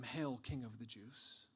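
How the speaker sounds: slow, low mock homage. Soldiers pay false homage to Jesus, quietly mocking. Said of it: "they began to mock him".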